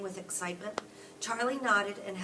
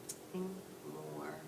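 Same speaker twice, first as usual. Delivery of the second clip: even lower disappointed echo; softer, slower letdown; 4 semitones lower, 9 dB quieter, slower than usual. Shown as a demonstration. Parent reading the letdown, echoing it softer. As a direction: let the hope drain out. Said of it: "repeated for effect".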